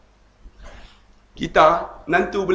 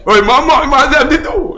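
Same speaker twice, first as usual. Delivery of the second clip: louder and higher, teasing; hammed-up father-in-law telling him off; mock scolding; 8 semitones higher, 7 dB louder than usual. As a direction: bark it like a grumpy uncle.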